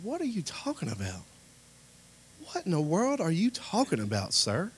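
high-pitched retold disbelief; shocked. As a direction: question him in baffled amazement. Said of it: "shocked by this".

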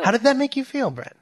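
high, loud mock interviewer voice; hammed-up talk show host asking his co-host. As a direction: play a gushing TV host.

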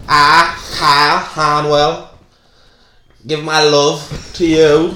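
Kyle. Acted. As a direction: labour through vows as a dishevelled groom. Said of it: "at the altar about to do my vows".

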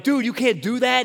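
high-pitched voice of indignant teammates; retold mild outcry; protesting teammate. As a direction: call out a foul as a shocked player.

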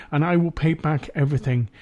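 ordinary talk

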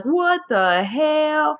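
high, slow, stretched-out shock; drawn-out stunned what the hell. Retold or imitated. Describing his painted face to friends, stretched high disbelief.

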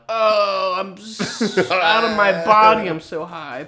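high, loud, slow hammed-up stoner voice; mock tripping. Joking about acid in England, in a high mock tripping voice. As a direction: float away like someone on acid.

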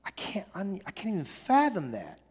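hushed, stunned disbelief at God's patience; can't believe how patient God is, said softly and amazed; incredulous disbelief. Retold or imitated. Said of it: "And we're just like".

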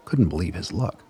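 ordinary delivery

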